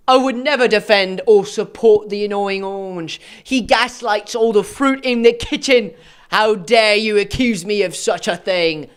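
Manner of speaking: put-on British indignation; mock British accent. YouTuber voicing Jesse denying support for the orange, mock British indignation. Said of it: "I'm not doing an accent".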